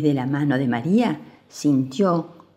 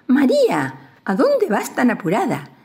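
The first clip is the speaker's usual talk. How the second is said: high, louder owl call; lightly voiced owl calling out; owl's screech; 7 semitones higher, 3 dB louder than usual. A narrator's reading. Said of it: "la lechuza abrió los ojos y chilló".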